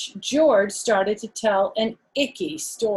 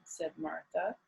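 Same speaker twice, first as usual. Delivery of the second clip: usual speaking voice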